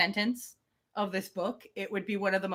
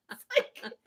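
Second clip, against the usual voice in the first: normal talking voice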